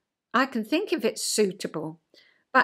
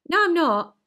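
higher, louder mock protest; indignant denial; 7 semitones higher, 4 dB louder than usual. Husband woken while dozing, higher indignant denial. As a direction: snap back a denial, hammed-up. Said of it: "promptly opened his eyes and said".

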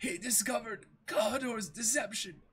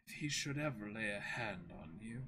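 lower, softer quoted butler; recalled villain; 11 semitones lower, 9 dB quieter than usual. Retold or imitated. Kaya echoes Klahadore's old promise, lower and soft. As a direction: murmur the remembered promise low.

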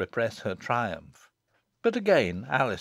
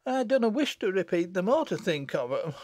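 high, tearful dialect voice; sobbing; 8 semitones higher than usual. A narrator's reading. Susan frightened by Jennet's threat, sobbing. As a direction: say it through mild sobs. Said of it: "sobbed Susan".

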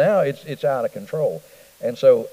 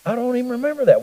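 baffled husband; 11 semitones higher, faster than usual. Retold or imitated. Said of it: "he was like".

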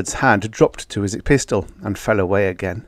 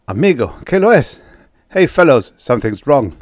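alarmed; 5 semitones higher, 7 dB louder than usual. Acted. Sudden alarm at the fire.

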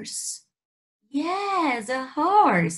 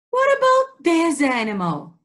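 ordinary talk

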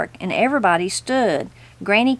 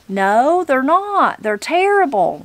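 higher, emphatic granny retort; prompt retort; 5 semitones higher than usual. A narrator's reading. Granny contradicts the judge's pleasantry, prompt retort. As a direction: answer straight back, pitch jumping up. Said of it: "responded Granny promptly".

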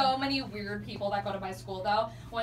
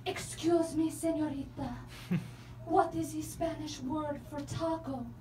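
fake Spanish accent; 2 semitones higher, 5 dB quieter than usual. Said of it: "the most authentic Spanish accent".

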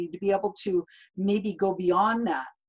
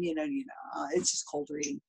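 soft unvoiced whisper, lower; whispered aside; 4 semitones lower, 8 dB quieter than usual. Real, not acted. Host adding a hushed aside to guest about cold reading, whispering. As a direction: let it slip out as a hushed aside.

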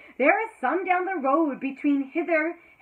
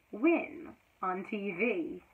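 ordinary delivery

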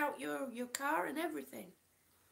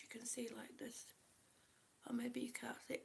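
whispered commentary; 4 semitones lower, 11 dB quieter than usual. Real, not acted.

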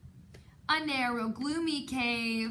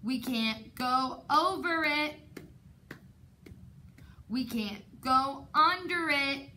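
usual speaking voice

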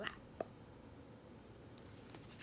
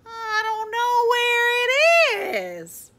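high, loud, slow protest; childish wail; 12 dB louder, slower than usual. A narrator's reading. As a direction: wail it out, stretching the last words. Froggy wailing back to his father.

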